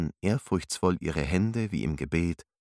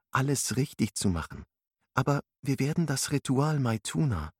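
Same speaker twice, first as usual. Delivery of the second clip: higher, gentle female character; young woman voice; 7 semitones higher than usual. Acted. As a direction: speak as a kind young teacher. Alice's voice in narration.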